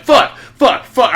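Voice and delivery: high, loud bursts of swearing; loud cursing. Retold or imitated. Man cursing at himself in the bathroom, loudly. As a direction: spit out each curse separately. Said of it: "I'm just cursing, like, loudly".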